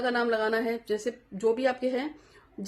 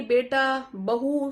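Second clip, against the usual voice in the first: ordinary delivery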